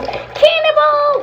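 drawn-out high cannonball yell. Pretend. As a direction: shout it slowly as you jump. Toy jumps into the pool.

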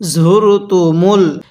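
the word drawn out slowly; slow demo reading. Shown as a demonstration. Teacher reads slowly for students to repeat. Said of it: "আমার সাথে পড়ুন".

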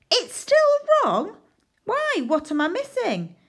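very high hammed-up dismay; mock shock. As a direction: act horrified for the class. Mock shock, still wrong.

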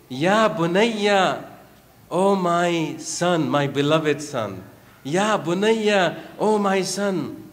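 slow, loving address to a son; tender father. Retold or imitated. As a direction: call him gently, full of love.

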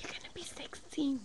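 soft hushed whisper. Real, not acted. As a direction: whisper so nobody hears. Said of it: "we're trying to be quiet".

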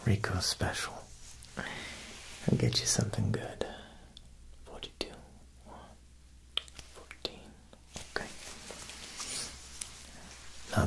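usual speaking voice

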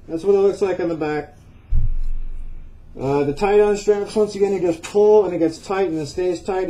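muffled behind mask; speaking through respirator cartridges. Real, not acted. Seen 0: Cartridge respirator covers mouth and nose throughout; reference frames show it hand-held.

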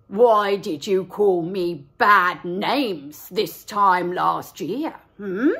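low, slow accusing wolf; a loud menacing wolf. Acted.

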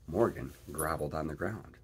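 ordinary delivery

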